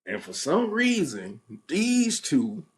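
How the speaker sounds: ordinary talk, nothing marked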